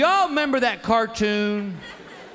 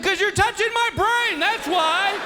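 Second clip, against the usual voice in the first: indignant retort; 12 semitones higher than usual. Retold or imitated. Comic snapping at the doctor.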